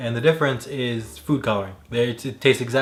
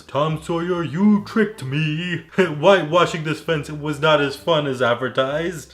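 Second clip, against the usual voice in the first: higher, louder cartoon brain voice, slow on "you tricked me"; big brain alien; 4 semitones higher, 5 dB louder, slower than usual. Host quoting a Futurama brain alien, hammed-up cartoon voice. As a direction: ham up a pompous alien brain. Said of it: "one of the big brains goes".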